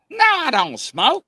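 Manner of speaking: high, loud, slow protest; indignant denial. Retold or imitated. Grandson denies smoking to grandma, indignant high protest. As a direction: insist loudly on innocence.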